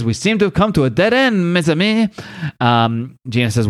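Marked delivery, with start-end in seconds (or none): 0.0-2.1 higher-pitched mock French accent. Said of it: "Frenchie says".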